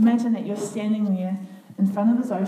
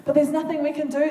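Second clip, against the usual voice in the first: raised frightened cry; desperate scream; 6 semitones higher than usual. Acted. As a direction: scream it in despair as a frightened crowd. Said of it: "they screamed".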